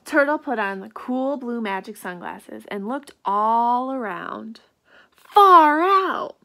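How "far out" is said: excited shout. Acted. Turtle delighted, exclaims.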